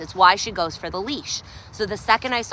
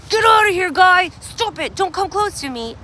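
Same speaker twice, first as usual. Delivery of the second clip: voicing the dog's warning; 9 semitones higher, 6 dB louder than usual. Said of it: "she said".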